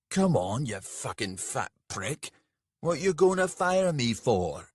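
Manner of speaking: loud, goading insult; angry taunt. A narrator's reading. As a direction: sneer and push him. Dull insults Rika angrily.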